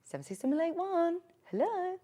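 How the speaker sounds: a hint of granny answering the phone; number then a sing-song hello. Mimicking granny reciting her number down the phone. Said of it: "my granny used to always do that".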